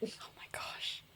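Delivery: breathless whispered oh my gosh; hushed whisper. Real, not acted. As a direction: whisper it in shock. Reacting to ending the episode.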